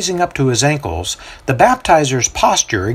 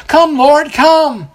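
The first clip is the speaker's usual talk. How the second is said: high, loud pleading cry; crowd calling out; 6 dB louder, much slower than usual. A narrator's reading. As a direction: call on the Lord with fervour.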